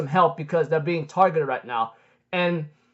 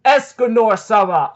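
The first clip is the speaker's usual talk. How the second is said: slow, louder mock-heroic announcement; grand boastful proclamation; 3 semitones higher, 5 dB louder, slower than usual. Escanor introduces himself to the demons, slow grand proclamation. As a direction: proclaim the title slowly and proudly.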